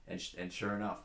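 ordinary talk, nothing marked

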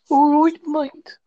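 put-on a weak Scouse accent try; Liverpool accent. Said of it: "I can't do a Liverpool accent".

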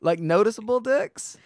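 incredulous. Real, not acted. Incredulous question.

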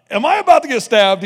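loud, high retold panic; retelling fear, voice jumping up; mock alarm. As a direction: blurt it out as an alarmed thought. Retold thought at knife standoff.

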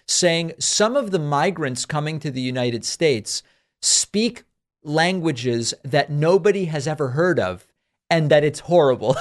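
ordinary talk

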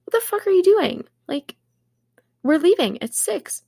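mock yell at him, climbing high; retold yell. Sister retelling yelling at brother left behind, mock yell. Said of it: "And I yelled at him".

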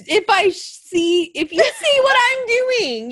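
flustered laugh-talk. Real, not acted. Embarrassed listener.

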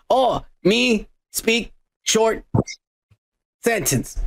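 halting, drawn-out caveman delivery; one slow word at a time, with long gaps; Kevin. Acted. Imitating the Office's Kevin skipping words, slow and halting. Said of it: "Kevin, when he was like".

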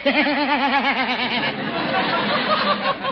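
long teasing belly laugh; mocking laugh. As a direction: laugh at a friend's getup. Judge sees the outfit.

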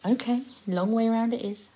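usual speaking voice

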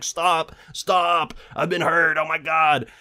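imitated cry of distress, not shouted; pleading injured man. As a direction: act out a hurt man flagging someone down. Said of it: "he's like".

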